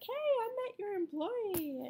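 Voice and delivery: high, bright imitated greeting; chirpy church lady. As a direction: mimic a cheery acquaintance, high. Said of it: "go to church and be like".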